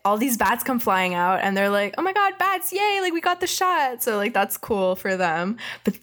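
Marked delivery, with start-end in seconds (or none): 2.0-4.0 much higher mock delight; high, giddy mock cheer; excited. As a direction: squeal with joy at finally getting the shot. Crew cheers seeing the bats.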